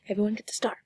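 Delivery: whispered aside; soft unvoiced whisper to the class. Real, not acted. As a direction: whisper it like a little secret.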